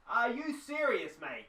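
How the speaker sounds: incredulous reporter. Acted. Doubting reporter at press conference.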